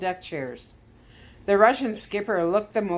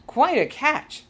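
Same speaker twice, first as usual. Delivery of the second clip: gloating declaration; 8 semitones higher, 5 dB louder, slower than usual. A narrator's reading. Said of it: "and declared".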